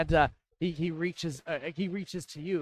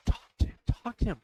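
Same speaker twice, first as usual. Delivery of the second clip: put-on higher, fast urging child voice; granddaughter mouthing; 8 semitones higher, 3 dB louder, much faster than usual. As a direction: egg grandpa on, quick and bright-eyed. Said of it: "kind of mouthing".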